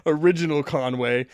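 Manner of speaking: loud, lightly hammed-up tour leader voice; mock announcement. Mocking friend's trip plan.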